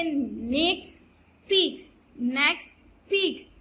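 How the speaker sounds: "नेक्स" and "पीक्स" said in turn, each one clearly stressed; rhyming pair demo. Shown as a demonstration. Teacher showing pupils that the two words rhyme, clear and deliberate. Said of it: "जब आप लोग इसको pronounce करेंगे".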